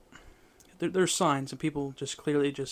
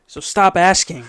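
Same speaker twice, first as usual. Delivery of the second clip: much louder, higher, playful telling-off; playful exasperation; 4 semitones higher, 16 dB louder, slower than usual. Real, not acted. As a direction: tell them off loudly, half joking. Mock-scolding nosy listeners, then laughter.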